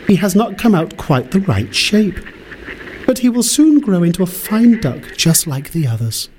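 high-pitched storybook mother duck; high character voice defending her duckling; mother duck explaining. Acted.

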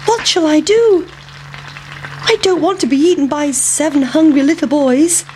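high, bright little pancake in a panic; high-pitched worried pancake. Acted. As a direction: squeak out the pancake's alarm. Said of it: "thought the pancake".

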